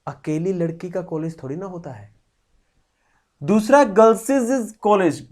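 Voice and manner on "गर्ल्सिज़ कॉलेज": put-on गर्ल्स said as "girls-iz", an extra syllable; wrong double-s "girlsiz" for गर्ल्स; doubled s. Shown as a demonstration. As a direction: stretch गर्ल्स into "girl-siz" before college. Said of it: "इसमें दो बार एस आ जाता है".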